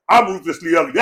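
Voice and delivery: ordinary talk, nothing marked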